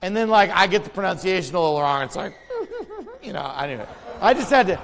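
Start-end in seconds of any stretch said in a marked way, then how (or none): 2.4-3.2 high, soft burst of laughter. Real, not acted. The preacher laughs at his own Greek story, high. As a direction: laugh lightly at yourself.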